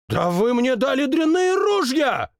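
high, angry royal complaint; indignant king. Acted. King blames the muskets, high and indignant. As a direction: blame the guns furiously.

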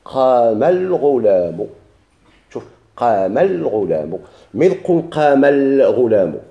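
قام الغلام slowly and carefully, with the الغلام ending spoken in full; teacher saying قام الغلام word by word; slow demo pronunciation. Shown as a demonstration. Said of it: "هو اللي قلنا".

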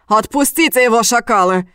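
angry yell. A narrator's reading. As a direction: bellow it like a furious demand. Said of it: "заорал старший". (яростный крик)

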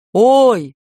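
sharp, loud startled yelp; startled cry. Acted. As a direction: yelp in alarm. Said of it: "закричал Гайдо".

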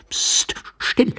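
high, hissed shush and order; voiceless "psst", then a high-pitched command; urgent hushing. Acted. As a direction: shush him sharply for silence. Stranger hushes the other man.

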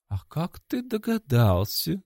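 high, slow mocking demon voice. A narrator's reading. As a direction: sneer the question at him. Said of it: "издевательски спросил Максвелл".

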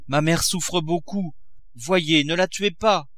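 a touch of desperate pleading; pleading daughter. A narrator's reading. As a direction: beg for mercy. Daughter begs father on her knees.

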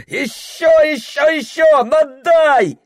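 high straining cries; urging shout. Acted. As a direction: push the rower on hard. Rowing hard past the rapids. (подгоняющий крик)

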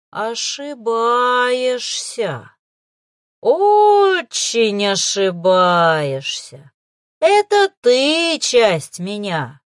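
slow, loud gloating demonic hiss; smug hiss. A narrator's reading. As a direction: hiss it out, savouring power. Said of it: "самодовольно прошипела тьма".

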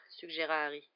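usual speaking voice

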